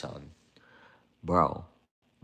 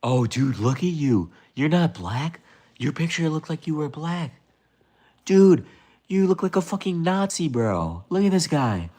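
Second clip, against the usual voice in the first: high, mocking put-on voice; mock the guy in a high squeaky tone; high-pitched mock voice jeering at a viewer's photo; 10 semitones higher, 4 dB louder than usual.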